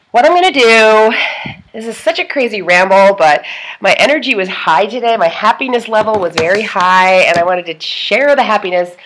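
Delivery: ordinary delivery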